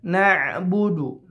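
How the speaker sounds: na'budu with the ain sounded from the throat; ain clearly felt. Shown as a demonstration. Teacher demonstrating the ain in na'budu, slow and clear. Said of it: "na'budu harus terasa ainnya".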